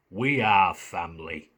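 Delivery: gravelly Scouse catchphrase with gravitas; Ricky Tomlinson. Retold or imitated. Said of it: "Ricky Tomlinson".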